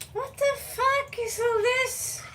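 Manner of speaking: real bafflement at the tripod parts; baffled outburst. Real, not acted. Vlogger fumbling with the new tripod, baffled high outburst.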